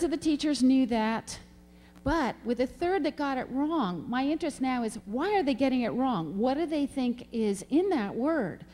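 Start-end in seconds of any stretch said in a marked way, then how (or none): none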